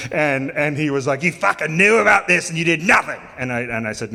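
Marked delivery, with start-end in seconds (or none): 1.2-3.2 louder, raised, accusing rant; angry accusation. Retold or imitated. Craig blaming Jeff for the lizard mess, angry accusation. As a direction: throw the blame at him.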